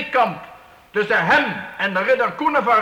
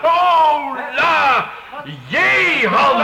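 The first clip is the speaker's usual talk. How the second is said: calling out; 4 semitones higher, much slower than usual. Acted. Count calls across the hall.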